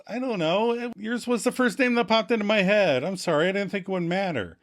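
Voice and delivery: high, feeble excuse-making; mock-defensive whine up high; meager defense. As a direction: plead weakly, speeding up at the end. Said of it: "Ross gives a meager defense".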